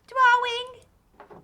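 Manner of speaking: put-on high, loud child voice; squeaky kid greeting.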